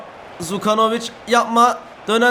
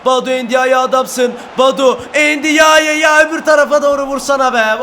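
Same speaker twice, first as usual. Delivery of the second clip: loud, high urging yell; shouting; 4 semitones higher, 5 dB louder than usual. Real, not acted. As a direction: yell at the player to shoot. Urging player to shoot.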